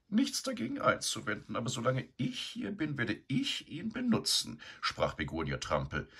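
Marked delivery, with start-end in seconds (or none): 0.1-4.6 high, lofty dismissal; haughty sow. A narrator's reading. Begonia lying in his stall, haughty and high. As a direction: brush him off without looking.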